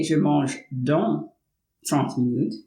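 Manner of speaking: "Je mange dans trente minutes" said slowly in careful French; slow French model sentence, leaning on "dans"; French demo. Shown as a demonstration. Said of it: "If I were to say".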